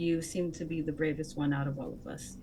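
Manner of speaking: softly spoken into her ear; acted whisper. Player's character praises a friend in a hug, whispering. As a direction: keep it hushed, a private word of praise. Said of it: "I'm gonna whisper in her".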